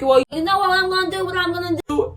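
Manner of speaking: mocking the principal. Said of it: "He's like".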